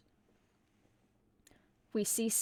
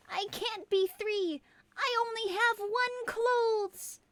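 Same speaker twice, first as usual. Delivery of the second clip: much higher, slow, crying elf; high whiny elf; 11 semitones higher, 3 dB louder than usual. Acted. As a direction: whimper it like a panicked little elf. Elf panics.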